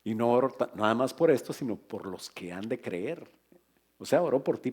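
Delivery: normal talking voice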